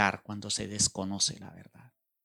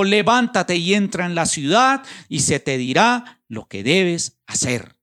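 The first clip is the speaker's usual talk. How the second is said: loud, high command, slowing at the end; commanding shout; 7 semitones higher, 8 dB louder than usual. Acted. The Lord ordering Saul into the city, a loud shout. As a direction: order him up with full preaching force. Preacher voices the Lord's command.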